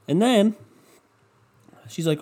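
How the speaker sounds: ordinary talk, nothing marked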